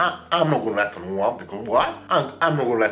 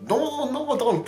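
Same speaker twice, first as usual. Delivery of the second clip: high squeal no; much slower than usual. Acted. Scolding imagined viewer Lucy.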